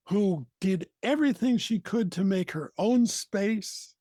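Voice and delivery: usual speaking voice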